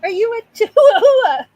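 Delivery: high, loud question breaking into laughter; giddy laughing question to the pet; laughing squeal. Real, not acted.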